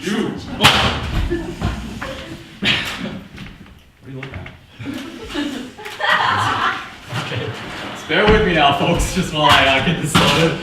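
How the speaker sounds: low, drawling president voice; a slick low politician; deep-voiced president. President character takes stage.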